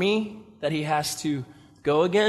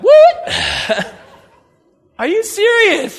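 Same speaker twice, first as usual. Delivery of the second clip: much higher, loud laughing shock; incredulous laugh; 9 dB louder, much slower than usual. Acted. Preacher reacts to twenty-two thousand leaving, laughing shock. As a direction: laugh in disbelief.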